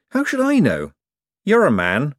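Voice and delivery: high-voiced woman snapping back at Moist; tart retort. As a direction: toss off the answer like it's obvious. Adora Bell retorts to Moist.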